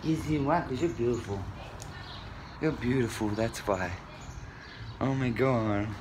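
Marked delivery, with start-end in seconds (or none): none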